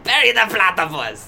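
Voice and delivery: loud, hammed-up Doofenshmirtz German accent; bad German accent. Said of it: "German accent from Doofenshmirtz".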